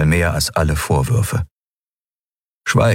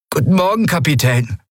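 higher, with a stuffed-up nose; stuffy nose; 9 semitones higher than usual. A narrator's reading. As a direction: talk through a blocked nose, rising on Morgen. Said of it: "sagte Miko mit verschnupfter Nase".

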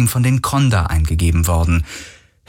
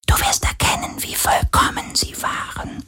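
soft eerie whisper of a sea spirit; wraith whisper; 5 dB quieter than usual. A narrator's reading. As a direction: hush it like a seductive ghost. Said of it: "flüsterte der Geist des Meeres".

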